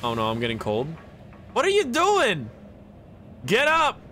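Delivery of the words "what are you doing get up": high, exasperated shout; exasperated yell. Real, not acted. Player ordering a stalled game character to get up, exasperated yell. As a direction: yell an order with the pitch leaping up.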